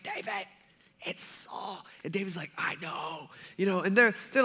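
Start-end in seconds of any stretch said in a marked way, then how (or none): 0.0-1.8 hushed, secretive whisper; very soft stage whisper; acted whisper. Hidden men secretly alert David. 2.6-3.3 very soft, secretive answer; imitating a furtive murmur; acted hushed reply. David answers the men in hiding.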